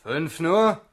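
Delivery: loud aggrieved recruit; indignant protest. Acted. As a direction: complain you were cheated.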